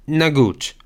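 ordinary talk, nothing marked

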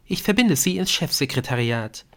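lightly voiced receptionist; switchboard operator. A narrator's reading. Switchboard operator answers the call.

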